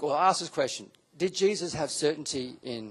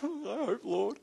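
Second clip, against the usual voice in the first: mock timid prayer; 9 semitones higher, slower than usual. Pastor mimics a believer praying with weak hope, high and timid. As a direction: squeak out a half-hearted hope.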